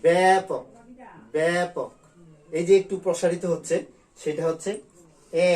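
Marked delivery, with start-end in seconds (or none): 0.0-1.9 ব্যাপক with the first syllable loud, lips spread; teacher modelling ব্যাপক; demo pronunciation. Shown as a demonstration. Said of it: "তাহলে করতে হবে".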